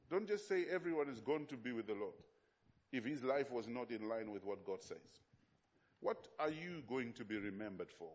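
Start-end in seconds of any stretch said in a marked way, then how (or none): none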